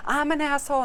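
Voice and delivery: high-pitched mock outburst; imitated popping off. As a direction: mimic a hot-head's rant. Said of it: "popping off on everybody".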